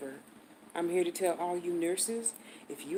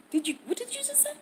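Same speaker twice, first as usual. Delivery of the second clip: disbelief; faster than usual. Retold or imitated. Disbelief at the receptionist.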